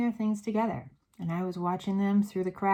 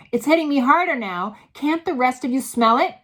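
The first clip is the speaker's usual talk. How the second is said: higher, louder urging; loud urgent; 6 semitones higher, 10 dB louder than usual. A narrator's reading. Mildred urging the other witches, loud and high. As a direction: demand they smell it too.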